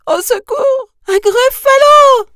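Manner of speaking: very high, loud cry for help; full-voiced high scream of alarm; terrified scream. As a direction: shriek in panic at a monster. Mouse meets the real monster.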